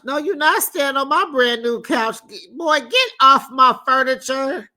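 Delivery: mock scold a guest off the couch; hammed-up indignant host; outraged homeowner.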